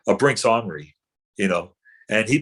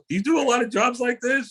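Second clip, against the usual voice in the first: high, put-on voice of a co-star sizing up a bum; mock the actors quizzing the street bum; wary co-stars; 13 semitones higher than usual. Co-stars quizzing the supposed bum, high mock voice. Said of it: "they're walking around me like".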